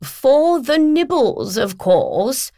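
deliberately slow, patronising agent; slow and condescending. Acted. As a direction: spell it out to a slow pupil. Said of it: "Philomena said slowly, as though speaking to an inattentive child".

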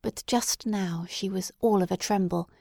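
plain reading voice

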